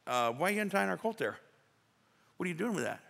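sudden high-pitched "hey, hey, hey, wait a minute"; protesting owner. Retold or imitated. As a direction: play the startled owner calling out to stop the men. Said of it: "cared enough about their colt to say".

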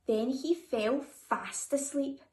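usual speaking voice

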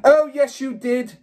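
high, loud furious roar; roared back. A narrator's reading. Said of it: "the Trunchbull roared back".

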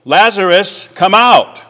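high, loud commanding call; loud cry. Acted. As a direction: summon him out of the tomb with a full shout. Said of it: "he cried out in a loud voice".

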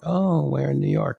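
high, mimicked probing question; imitated curious agent.

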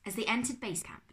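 plain reading voice

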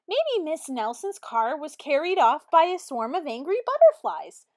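loud, eager rising claim; know-it-all kid. A narrator's reading. Know-it-all kid sharing a wild guess, loud and eager. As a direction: show off the silly theory. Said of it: "one know-it-all".

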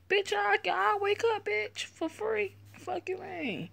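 high, mocking squeal of defiance; shrill-pitched sarcastic refusal, fading off; sassy squeal. Real, not acted. Sassy reply to the dream choice.

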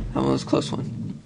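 soft breathless whisper. Real, not acted. Gamer after a near miss, whispered relief.

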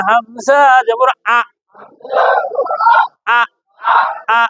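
আ as a short, hard burst from the throat; hard hamza. Shown as a demonstration. Teacher drills hamza from the top of the throat, hard short আ. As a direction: cut আ off sharply with a firm glottal stop. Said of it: "শক্তভাবে আদায় হবে".

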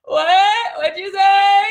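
high, loud yawning question; stretched, open-throated yell. Acted. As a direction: call it out through a yawn. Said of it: "Like that. Do you hear that?".

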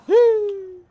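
high loud triumphant whoop; excited whoop. Real, not acted. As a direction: cheer out loud. Landing a kill in game.

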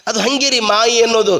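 normal talking voice

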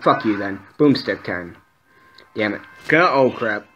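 ordinary delivery

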